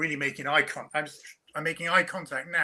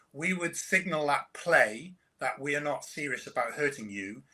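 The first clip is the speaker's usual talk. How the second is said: ordinary delivery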